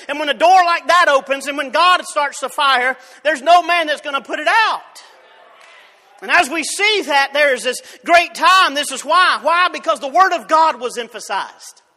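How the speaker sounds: loud, driving revival preaching; preaching at full force. Acted. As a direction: build it like a fire catching. Preaching climax, rising force.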